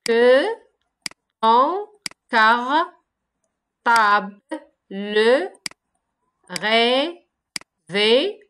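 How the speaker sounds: each syllable said apart with long pauses; slow syllable-counting read. Shown as a demonstration. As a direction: tap out every syllable separately. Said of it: "Chaque vers se forme des syllabes".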